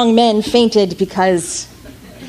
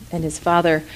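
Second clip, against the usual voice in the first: ordinary delivery, nothing marked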